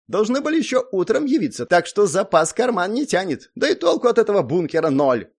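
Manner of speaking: high, loud, cunning character voice; sly Zak. A narrator's reading. Zak justifying stripping the bunker, sly. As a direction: argue it slyly, pitched well up. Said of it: "хмыкнул хитро прищурившись Зак".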